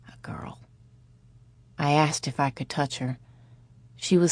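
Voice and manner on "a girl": breathy hushed whisper, no voice; doctor to the girl, whispering gently. A narrator's reading. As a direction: barely breathe the words. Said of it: "The doctor whispered his answer".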